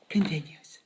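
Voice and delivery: whisper. Acted. Mock dramatic whisper close.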